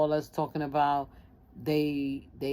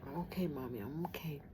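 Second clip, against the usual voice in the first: hushed, softened reassurance; retold tearful; 11 dB quieter than usual. Daughter reassuring her mother on the phone, tearful. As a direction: relive answering through tears, very soft. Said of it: "and I'm crying".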